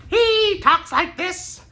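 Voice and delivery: very high-pitched character voice; high voice of an odd, happy character; Bobby Justin impression. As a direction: put on a high, loud eccentric voice. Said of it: "I have to do a Bobby Justin impression for you".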